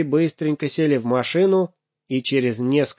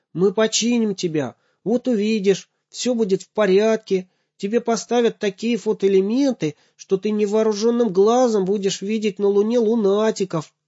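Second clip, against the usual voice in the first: put-on reassuring child; 10 semitones higher than usual. Boy comforting the broken robot.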